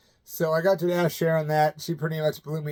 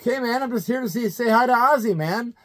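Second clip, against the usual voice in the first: put-on eager teen; 7 semitones higher, 3 dB louder than usual. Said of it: "I'm like".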